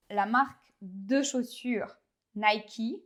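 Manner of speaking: Nike said the English way, NAI-kee; English brand name, English accent; English pronunciation. Shown as a demonstration. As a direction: say Nike as an English speaker would. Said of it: "personne ne va dire ça".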